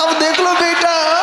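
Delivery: high, drawn-out crowing; playful, high-pitched gloat, words stretched out; retold gloating taunt. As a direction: crow it slowly in mock triumph. Retold gloating at the man outside.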